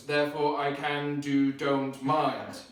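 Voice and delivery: usual speaking voice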